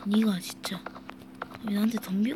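whispered grumbling under breath; angry whisper. Real, not acted. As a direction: mutter at the enemy. Muttering at opponent in game.

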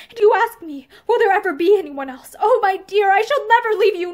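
high, loud, fervent declaration; impassioned vow. A narrator's reading. Lover promising married woman he will never leave, impassioned vow. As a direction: swear devotion with full feeling. Lover vows lasting devotion.

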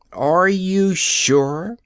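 very slow, high, pointed stern question. Acted. Ozma questions the Nome King, slow stern demand. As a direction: draw each word out, demanding certainty. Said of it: "asked Ozma sternly".

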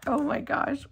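normal talking voice

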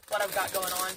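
usual speaking voice

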